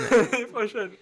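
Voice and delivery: high, loud, through laughter; laughing. Real, not acted. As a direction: laugh out the goodbye. Laughing sign-off.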